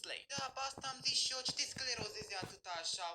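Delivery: ordinary delivery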